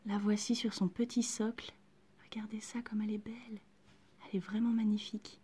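soft hushed whisper over the doll. Real, not acted. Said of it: "Je chuchote un peu". (en chuchotant tout doucement)

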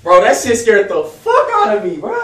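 loud, rattled exclamation; still shaken. Real, not acted. Retelling jump scare.

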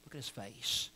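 tender quiet urging; hushed repeat. Real, not acted. Repeated softly.

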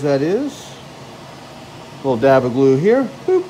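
ordinary talk

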